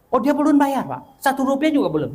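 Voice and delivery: high-pitched retold disbelief; incredulous questioning. Asking the seller if not one rupiah is paid, incredulous. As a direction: press the question, pitch jumping up.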